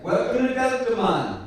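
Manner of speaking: high, loud Jamaican pilot over the intercom; put-on Jamaican accent, pitched well up and projected. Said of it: "Jamaican, way too laid back an accent".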